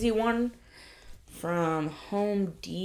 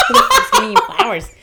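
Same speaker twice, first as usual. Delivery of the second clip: much higher and louder, laughing through it; laughing mock outrage; 9 dB louder than usual. Coworker repeats the flower question, laughing loudly. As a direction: blurt it out cracking up. Retold confrontation over flowers.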